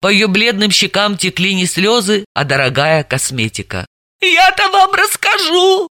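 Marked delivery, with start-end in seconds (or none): 4.2-5.9 high, slow, drawn-out whine; whining. Acted. Tearful suspect to detective, slow whine. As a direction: moan it self-pityingly. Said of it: "проныла она".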